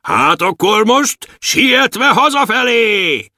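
slow, high, sing-song dwarf; a little captain calling the way home, slow and high. Acted. Dwarf Bobolysa at the helm, high and slow.

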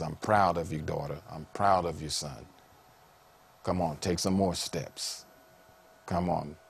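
soft, low reassuring parent voice; tender fatherly encouragement. Acted. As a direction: speak gently, like a proud father coaxing a child. Voices a heavenly father encouraging children.

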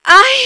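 short loud "ay" yell, cut off at once; mock scream. Shown as a demonstration. Singer tries the requested yell once, loud and short. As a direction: let out one loud yell, then stop. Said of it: "pero grita así".

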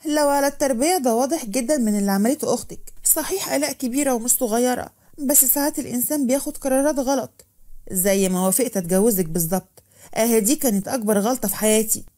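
ordinary delivery, nothing marked

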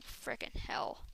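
defeated gamer whispering a curse; whispered curse. Real, not acted. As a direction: hiss it under your breath. Muttered curse after death.

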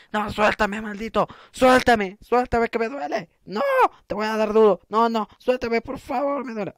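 playful distress, climbing in pitch; mock pleading. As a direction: plead to be let go, hammed up. Begs the cactus to let go.

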